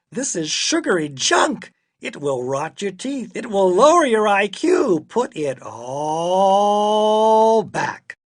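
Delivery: scold, building to a long drawn-out order; fed-up father laying down the law; exasperated scolding. A narrator's reading. Father scolds daughter over junk.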